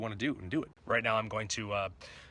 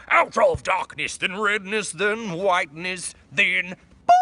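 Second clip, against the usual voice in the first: dramatic announcer outro; 7 semitones higher, 11 dB louder, slower than usual. Pretend.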